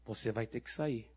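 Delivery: low, hushed firm order; very quiet command. Retold or imitated. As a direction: give the order softly under your breath. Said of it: "Eu falei bem baixinho".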